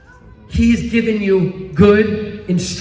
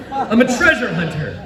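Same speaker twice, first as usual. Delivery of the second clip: ordinary talk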